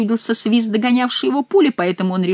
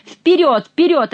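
urging shout; 9 semitones higher, 5 dB louder, slower than usual. A narrator's reading. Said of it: "ответил Шико".

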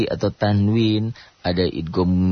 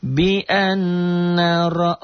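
slow, high chanted tilawah; Quran recitation; 10 semitones higher, much slower than usual. Shown as a demonstration. Teacher chanting the verse for students, slow and melodic. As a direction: draw each vowel out in melodic recitation.

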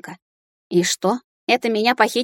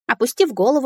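ordinary delivery

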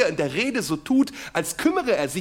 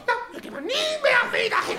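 very high indignant mother. Retold or imitated. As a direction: huff like an offended mum. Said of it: "und die Mutter". (hohe empörte Mutterstimme)

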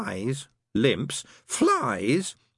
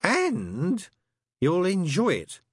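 slow, higher, unrelenting needling; relentless; 12 semitones higher, slower than usual. Acted. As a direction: keep pushing, savouring it. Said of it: "said Crowley relentlessly".

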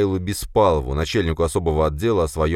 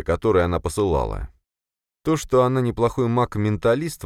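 plain reading voice

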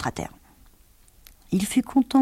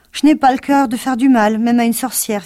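higher, louder earnest protest; tin woodman voice; 6 semitones higher, 7 dB louder than usual. Acted. Tin woodman telling friends he won't harm the witch, earnest. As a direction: insist you cannot hurt anyone.

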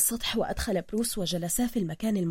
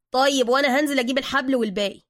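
loud, higher-pitched colloquial patter; lively, eager explaining in Cairene slang; chatty Egyptian sidekick; 4 semitones higher, 7 dB louder than usual. Acted. Suka unpacking bags for Bruce, chatty and loud.

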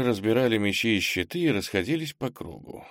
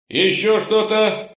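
loud, slow, higher demand; loud question; 11 semitones higher, 6 dB louder, slower than usual. A narrator's reading. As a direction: demand it loudly. Boss asks more.

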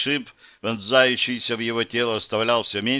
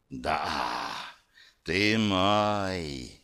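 low, hushed voice of darkness; creeping nightmare whisper; 5 semitones lower, 6 dB quieter, much slower than usual. Acted. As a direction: breathe it like shadow itself. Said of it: "прошептала тьма". (зловещий шёпот тьмы)

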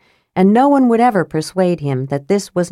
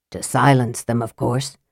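lightly voiced Raistlin, low and quiet; low voice, dropping softer at the end; Raistlin speaking; 6 semitones lower, 3 dB quieter than usual. A narrator's reading. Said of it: "said Raistlin".

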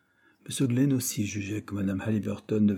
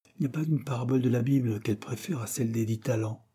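ordinary delivery

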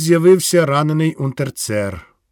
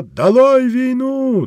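much higher, slow and louder; echoed slogan; 10 semitones higher, 3 dB louder, slower than usual. Acted. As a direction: cry the slogan out as the list peaks. Narrator climax echoing slogan.

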